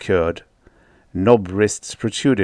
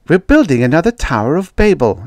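sharp, higher and louder; snapped; 7 semitones higher, 6 dB louder, faster than usual. A narrator's reading. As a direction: bite the line off in irritation. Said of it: "she snapped".

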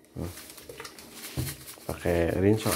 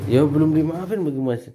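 higher-pitched, exasperated whine; exasperated protest; 7 semitones higher than usual. Real, not acted. Husband protests to sulking wife, exasperated.

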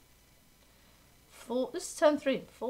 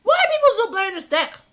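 much higher, louder and fast; exasperated outburst; 11 semitones higher, 11 dB louder, faster than usual. Real, not acted. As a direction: rant at the opponent. Exasperated at opponent's deck.

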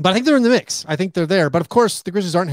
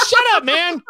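high, louder scoffing brush-off; mock dismissive fans; 12 semitones higher, 4 dB louder than usual. Fans brushing off the podcaster's take, high mock scoff. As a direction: wave him off like an annoyed fan. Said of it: "And everyone's like".

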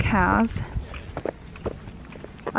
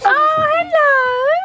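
excited; 5 dB louder than usual. Real, not acted. Mother cheering baby on swing.

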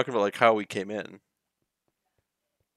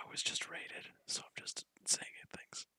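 hushed unvoiced whisper; 13 dB quieter than usual. Real, not acted. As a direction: whisper it, keep hidden. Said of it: "Why are we whispering?".

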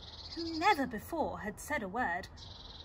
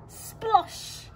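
high, loud splosh; splash noise; 10 semitones higher, 7 dB louder than usual. A narrator's reading. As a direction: make it a big splash. Sound of falling into pond.

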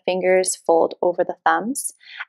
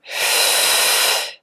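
long, audible breath in through the mouth; mouth inhale. Shown as a demonstration. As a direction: draw the air in noisily and steadily. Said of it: "You'll inhale through the mouth".

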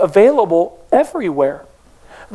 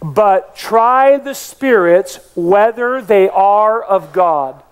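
spaced-out call to recite together; slow congregational recitation; much slower than usual. Shown as a demonstration. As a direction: lead the church in reciting, slowly. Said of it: "Say that with me".

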